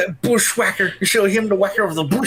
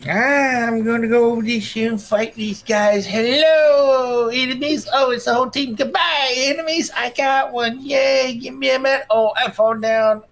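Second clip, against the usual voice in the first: higher, hammed-up old man narrating his game; playful geezer cheering and falling over; old man gamer voice; 5 semitones higher than usual. Husband continuing the YouTuber's old man improv. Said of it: "He does this old man voice".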